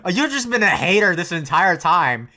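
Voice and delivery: loud, high, furious yelling at the screen; angry yell. Real, not acted. Streamer yelling at Asuka's inner voice, furious. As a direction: tell her off at full volume.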